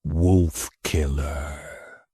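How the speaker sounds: very slow, low creature voice; eerie drawn-out whisper; low rasping whisper. A narrator's reading. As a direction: rasp the name out, mocking. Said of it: "just like the whisper before".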